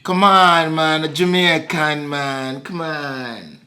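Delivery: coaxing Jamaican patois; Jamaican accent. Pretend. Claiming to be Jamaican, in a Jamaican accent. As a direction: protest in a put-on Jamaican accent. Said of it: "You just doing the accent".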